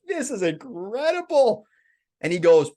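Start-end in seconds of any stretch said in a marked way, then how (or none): 0.1-1.7 awestruck. Retold or imitated. Pip in awe.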